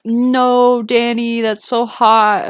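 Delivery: high, loud, sympathetic outcry; dismayed. Real, not acted. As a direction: cry out in dismay for a friend. Reacting to heat forecast.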